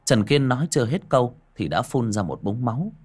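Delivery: usual speaking voice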